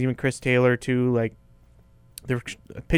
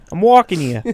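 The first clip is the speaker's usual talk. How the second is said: put-on high, loud New York-accented protest; mock New York accent; 5 semitones higher, 8 dB louder than usual. Co-host clowning for host, mock New York accent. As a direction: bark it like an indignant New Yorker.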